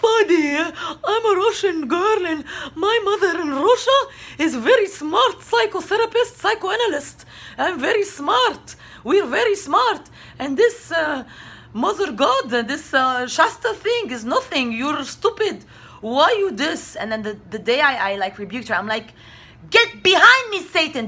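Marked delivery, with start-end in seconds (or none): 0.0-17.0 high, put-on Russian woman's voice, sneering; lampooning her as a know-it-all; mocking Russian mother. 19.7-21.1 high, loud rebuke; loud, high exorcism cry; rebuking shout. Retold or imitated. Rebuking Tatiana.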